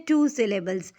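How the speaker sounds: ordinary delivery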